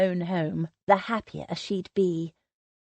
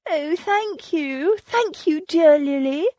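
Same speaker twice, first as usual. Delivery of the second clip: much higher, slow, effusive relief; gushing thanks; 9 semitones higher, 5 dB louder, slower than usual. A narrator's reading. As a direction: overflow with grateful relief, pitched high.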